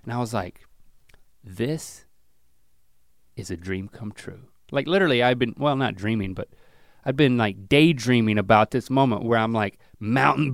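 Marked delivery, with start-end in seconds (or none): 1.4-4.5 hushed, halting, dropping away softly; grateful awe. Retold or imitated. Dad on the trail with his son, grateful awe. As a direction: savour it quietly with long pauses. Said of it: "a moment of dad gratitude".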